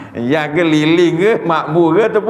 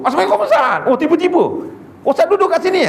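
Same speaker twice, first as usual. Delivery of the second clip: much higher mock beggar voice, laughing; wheedling beggar; 13 semitones higher, faster than usual. Beggar on a motorbike greets the ustaz, high wheedling mock voice. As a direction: sweet-talk the ustaz with a laugh.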